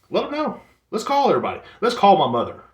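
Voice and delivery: high-pitched, mounting mock enthusiasm; sarcastic outburst. Sarcastic escalation of being exposed.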